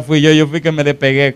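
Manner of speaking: normal talking voice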